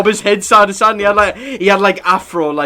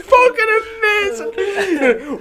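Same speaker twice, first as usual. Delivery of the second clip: high-pitched, slow words squeezed through laughter; helpless laughter; much slower than usual. Real, not acted. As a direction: barely get it out for laughing. Helpless laughter at own story.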